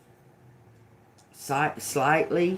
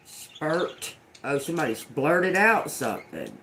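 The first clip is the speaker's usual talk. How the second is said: normal talking voice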